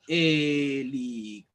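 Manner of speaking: HELICÓPTERO said slowly, syllable by syllable; split into syllables. Shown as a demonstration. Teacher showing pupils how to separate helicóptero, syllable by syllable. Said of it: "si nosotros sabemos separar".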